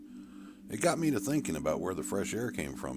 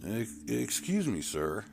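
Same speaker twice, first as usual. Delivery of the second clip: scared stammer; 6 semitones higher, slower than usual. Acted. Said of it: "I was scared".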